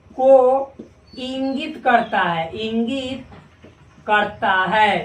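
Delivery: slow, deliberate teacher dictation; each word of को इंगित करता है set apart, slow. Shown as a demonstration. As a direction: spell it out slowly for students to write. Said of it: "क्या?".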